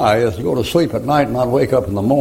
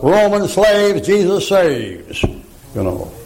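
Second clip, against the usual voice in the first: street preaching; 9 semitones higher, slower than usual. Shown as a demonstration. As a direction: call it out to passers-by like a street preacher. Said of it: "I preach in the street".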